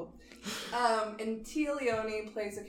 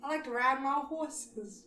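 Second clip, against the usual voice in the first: put-on southern accent; 4 semitones higher than usual. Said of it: "Was that whole sentence in a southern accent".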